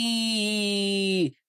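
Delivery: high gleeful squeal; excited squeal. Acted. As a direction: squeal with joy. Pinkie riding, squealing.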